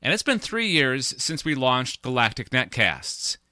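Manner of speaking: upbeat promo read voice. Acted. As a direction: pitch it like a sponsor plug. Sponsor plea read at the top.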